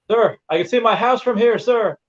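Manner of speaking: high, eager recruit voice; cheeky recruit. Retold or imitated. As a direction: chirp it like a wide-eyed recruit. Trainee joking to drill sergeant.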